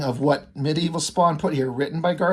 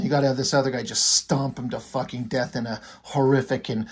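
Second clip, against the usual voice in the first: ordinary talk, nothing marked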